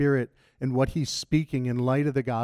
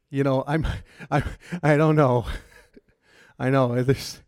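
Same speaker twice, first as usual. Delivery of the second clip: laughing; 4 dB louder than usual. Real, not acted. Laughing at his own confession.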